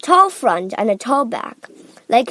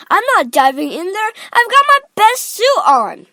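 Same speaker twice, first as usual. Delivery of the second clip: loud, raised refusal climbing higher; indignant protest; 10 semitones higher, 7 dB louder than usual. A narrator's reading. Father refusing to dive in, indignantly loud. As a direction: protest hotly, getting higher.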